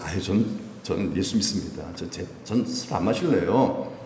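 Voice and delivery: low, soft, apologetic no; meek refusal. Acted. Believer turns down drinks at work, meek and low.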